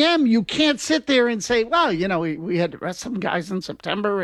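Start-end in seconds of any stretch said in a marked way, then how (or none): 1.6-4.2 softer, lightly mimicked excuse; mock excuse-making. Host imitating a GM explaining losses, lightly mocking excuse. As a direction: toss it off like a lame excuse.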